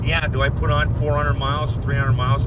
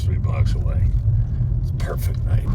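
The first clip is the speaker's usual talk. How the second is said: hushed, breathy whisper of a calm night. Real, not acted. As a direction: whisper it to yourself. Whispered aside over video.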